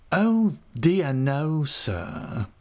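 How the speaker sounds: high, wheedling and obsequious; cringing humble clerk. A narrator's reading. Uriah humbly asking Creakle leave to write to mother. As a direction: creep and fawn as you ask.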